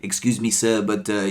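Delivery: put-on halting French-accented English. French player telling the dealer off, in French accent. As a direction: imitate a French player complaining politely. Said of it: "He was French, he didn't sound like that".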